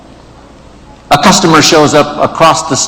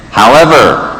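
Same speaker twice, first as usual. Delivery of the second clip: "However," higher, punched, then falling; "However," standing alone, raised and emphatic; stress on However; 4 semitones higher, 3 dB louder than usual. Acted. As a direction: hit "However," as an underlined word. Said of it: "underline the word however".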